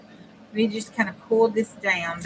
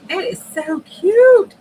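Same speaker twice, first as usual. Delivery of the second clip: gushing squeal; 12 semitones higher, 13 dB louder, slower than usual. Real, not acted. Gushing over cute cardinal picture.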